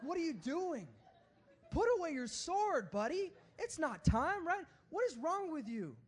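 high, loud comic telling-off; exasperated rebuke. Acted. Preacher as Jesus rebuking Peter at the arrest, exasperated. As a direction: berate a friend who has embarrassed you. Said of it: "call out Peter and rebuke him".

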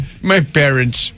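mock Irish accent; playful put-on Irish voice. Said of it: "I don't know why we're talking like that".

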